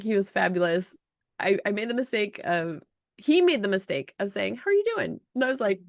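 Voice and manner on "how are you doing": lightly voiced chipper stranger, much higher; a breezy, sing-song hello; cheery greeting. Man at the airport lounge greeting her, light high mock cheer.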